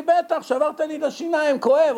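high-pitched, hurt protest; pained complaint. Retold or imitated. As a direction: play the slapped man protesting his pain. נסטר מתלונן על כאב. (חיקוי תלונה כאובה בקול גבוה)

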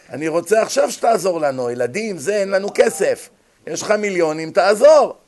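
rising insistent plea; pushy demand. Acted. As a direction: badger him for cash. Relative demanding money.

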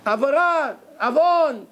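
קריאה חזקה וגבוהה: אש, עברה, עוון; לזעוק כמו מי שקופץ לעצור חטא; צעקת אזהרה. Retold or imitated. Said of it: "אני מיד אקפוץ ויצעק". (צעקה מחוקה וגבוהה)

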